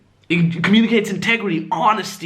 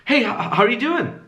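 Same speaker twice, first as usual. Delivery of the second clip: hesitant, higher-pitched greeting; value-taking tone; 6 semitones higher than usual. Shown as a demonstration. Showing students a needy greeting, hesitant and high. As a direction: stumble on HOW as if seeking approval. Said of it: "which one is value taking".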